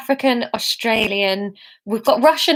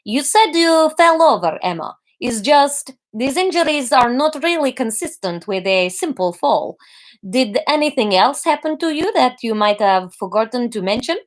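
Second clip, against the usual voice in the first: put-on Russian accent; 3 semitones higher than usual. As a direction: press gently in a Russian accent. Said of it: "This is Russian really based on Gru".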